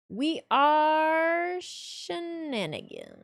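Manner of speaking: high, loud, very slow proclamation; mock-solemn proclamation; grand declaration. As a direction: drag out "We are shenanigans" like a grand announcement.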